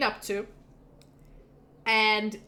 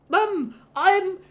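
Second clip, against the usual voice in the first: high, loud, exasperated whine; indignant protest; 10 semitones higher, 6 dB louder than usual. Acted. Grown son objecting to his mother, indignant protest. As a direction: insist on being treated as grown-up.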